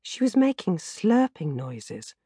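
plain reading voice

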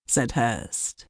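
usual speaking voice

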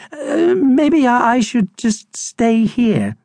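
high, slow, hesitant voice; timid Harold, reluctant. Acted. Hamster tries to avoid the adventure, high and hesitant. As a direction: falter nervously, trying to back out.

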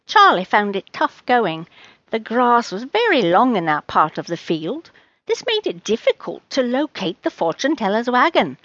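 ordinary delivery

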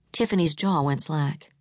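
ordinary delivery, nothing marked

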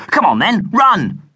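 high, loud shout to run; boy yelling to run. A narrator's reading. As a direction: yell at the partner to run. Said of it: "Tony Hoskins yelled".

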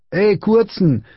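high-pitched bully's call. A narrator's reading. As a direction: call sharply after someone. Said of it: "rief der große Junge".

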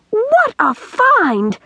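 very high, long drawn-out exclamation; ecstatic cry. A narrator's reading. Girl exults over the tin can, soaring ecstatic cry. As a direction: soar up and stretch each word in delight. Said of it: "Pippi gave a terrific yell".

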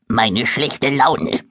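langsam und mürrisch gemuffelt; muffelig. Acted. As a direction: beleidigt vor sich hin maulen. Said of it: "muffelt das Monster". (das Monster muffelt Moritz langsam und mürrisch an)

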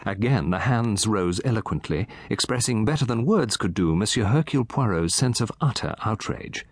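ordinary delivery

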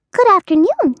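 high, bright squeaky greeting; high mouse voice. Acted.

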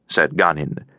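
ordinary delivery

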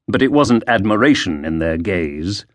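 ordinary delivery, nothing marked

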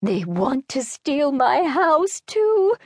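put-on high, slow, desperate elderly voice; an old lady begging not to be robbed; pleading old woman. A narrator's reading.

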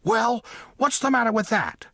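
high, indignant little mole voice; demanding mole. A narrator's reading. Mole challenges the cat mocking his name, demanding. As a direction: demand an answer in a high small voice. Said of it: "the mole demanded".

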